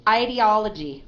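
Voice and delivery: IDEOLOGY with a long "eye" start, stress on OL; model pronunciation. Shown as a demonstration. Teacher models the word for a student, clear pronunciation of IDEOLOGY. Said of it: "If you say it wrong, I'll correct you".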